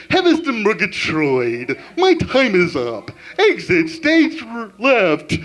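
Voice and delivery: slow, theatrical cartoon lion; Snagglepuss voice. Closing bit to audience, slow hammy cartoon lion. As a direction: ham it up like a cartoon lion exiting. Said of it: "one last voice is".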